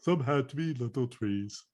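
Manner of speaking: slow gentle painter voice; soft soothing TV painter. Retold or imitated. Echoing Bob Ross line.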